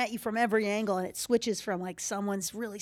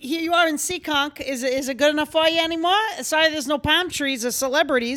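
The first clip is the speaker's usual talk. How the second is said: put-on louder, higher teasing relative with Boston accent; Boston family accent; 6 semitones higher, 7 dB louder than usual. Boston relative teases her about Beverly Hills. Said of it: "your impression that you do of your family".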